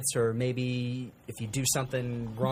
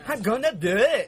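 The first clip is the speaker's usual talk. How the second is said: much higher, louder excited Donkey voice; Donkey impression; 10 semitones higher, 7 dB louder than usual. Pitching his own game idea, as Donkey from Shrek, laughing. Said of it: "It was Donkey from, uh, Shrek".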